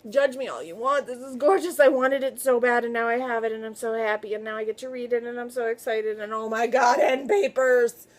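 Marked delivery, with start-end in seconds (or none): none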